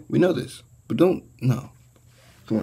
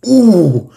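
loud, high falling hoot; mimicked "ooh"; 8 semitones higher, 6 dB louder than usual. Showing how he was going, loud mock ooh. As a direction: imitate a loud reaction with a sliding ooh. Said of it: "like this".

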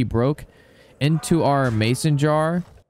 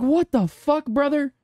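retold disbelief; 14 semitones higher, slower than usual. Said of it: "I was like".

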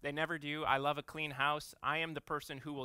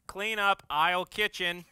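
louder, higher store-announcer call; kid's yell; 4 semitones higher, 10 dB louder, slower than usual. Retold or imitated. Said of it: "he yells".

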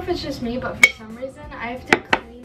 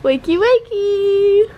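sing-song wake-up call; 10 semitones higher, 8 dB louder, much slower than usual. Real, not acted. Waking partner sing-song.

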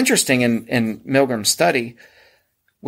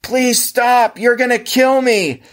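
high, louder mock plea; screamed begging; 11 semitones higher, 4 dB louder, slower than usual. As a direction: imitate a man screaming and begging for mercy. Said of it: "was literally screaming and begging".

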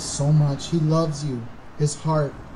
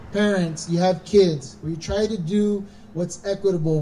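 normal talking voice

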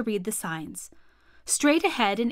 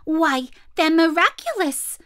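high, louder delighted gasp of wonder; amazed monkeys; 7 semitones higher, 5 dB louder than usual. Acted. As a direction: marvel at it like excited little monkeys. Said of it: "said the six little monkeys".

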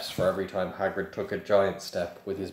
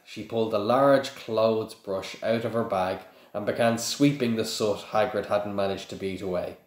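ordinary delivery

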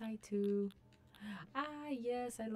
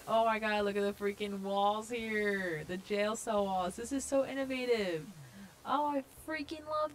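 hyped; 6 dB louder than usual. Real, not acted. Gamer amazed by the jail cell walls, hyped. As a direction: gush at full voice, stretching the words. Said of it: "I'm getting so hyped".

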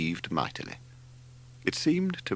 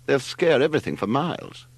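heated complaint, raised pitch; 6 semitones higher, 4 dB louder than usual. A narrator's reading. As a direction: complain with a touch of heat. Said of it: "he inquired heatedly".

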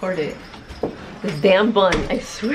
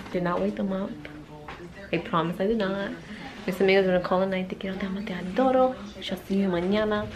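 soft, lowered voice; hushed; 3 semitones lower, 8 dB quieter than usual. Real, not acted. Speaker near sleepers signing off for the night, hushed. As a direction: keep your voice down. Defending self beside people just woken.

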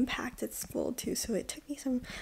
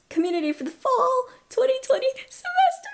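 voice breaking; 13 semitones higher, 6 dB louder, faster than usual. Real, not acted. Reading acceptance letter, breaking down.

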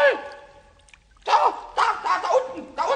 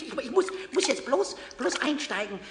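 ordinary delivery

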